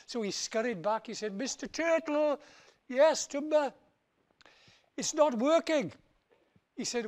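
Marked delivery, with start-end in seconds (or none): 1.3-5.9 whiny Tumba. Acted. Tumba whining to turtle.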